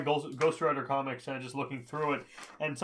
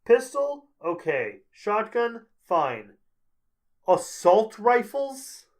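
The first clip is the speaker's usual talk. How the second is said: mock surprise; 6 semitones higher, 7 dB louder, much slower than usual. Listing guns with mock surprise.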